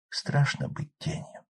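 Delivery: slow frightened whisper. Acted. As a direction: breathe it out like a shadow. Ghost girl's fearful whisper.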